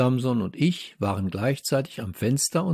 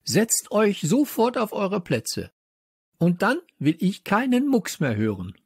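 high, stern teacher's order; thundering; 10 semitones higher than usual. A narrator's reading. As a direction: scold the whole class into silence. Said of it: "donnerte Popos Stimme".

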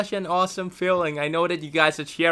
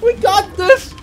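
high, loud excited yelling; excited shouting; 14 semitones higher, 13 dB louder than usual. Real, not acted. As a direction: shout it out, thrilled. Winning moment in game.

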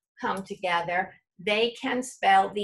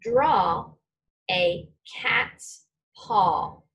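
slow dictation; much slower than usual. Shown as a demonstration. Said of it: "Please print the sentence".

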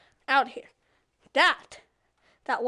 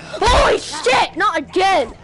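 loud, higher, drawn-out yell; startled shout; 3 semitones higher, 9 dB louder, slower than usual. Real, not acted. Shouting as zombies swarm the player again, loud. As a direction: yell in alarm as they close in.

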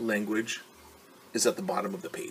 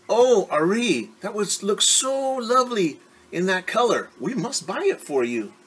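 much higher, gushing parent voice; doting parents; 10 semitones higher, 4 dB louder than usual. Acted. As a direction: gasp with delight like adoring parents. Parents crying out over silk.

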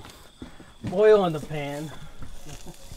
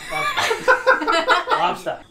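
"Lobster" squealed very high, Boston style; high hammed-up Boston "Lobster"; exaggerated Boston accent; 6 dB louder, slower than usual. Shown as a demonstration. Cook mocks his own accent for friends, squealing high. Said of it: "I know how to say lobster".

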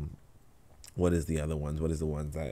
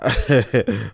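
amused laugh; 5 semitones higher, 10 dB louder than usual. Real, not acted. Amused by a viewer comment.

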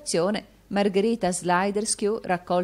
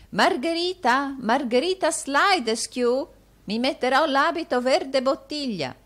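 put-on higher, animated old man's voice; excited old man; 7 semitones higher, 3 dB louder than usual. A narrator's reading. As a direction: muse eagerly, then call out for the housekeeper. Arturo muses, calls his housekeeper.

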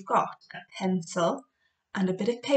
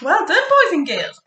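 excited praise; 9 semitones higher, 7 dB louder than usual. Acted. Praising children after activity.

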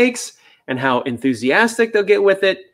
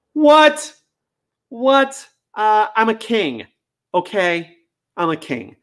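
indignant spoiled dog; 9 semitones higher, slower than usual. Pretend. A spoiled dog told to work, indignant. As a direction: sputter like royalty asked to work.

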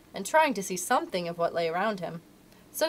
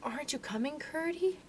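very soft, raised, hushed question; whispering; 6 semitones higher, 9 dB quieter than usual. A narrator's reading. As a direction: whisper close to someone's ear.

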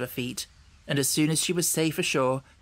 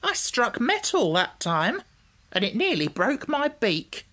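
higher-pitched, pained hen; indignant hurt hen; 8 semitones higher than usual. Acted. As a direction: complain as a hen with a sore beak. Narrator voices the hurt hen.

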